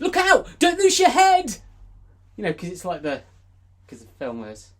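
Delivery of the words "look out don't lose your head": mock warning shout.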